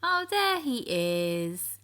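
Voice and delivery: slow, drawn-out discovery; fond slow storyteller reveal; found him. A narrator's reading. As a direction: reveal him gently like a surprise. Narrator finds Joe.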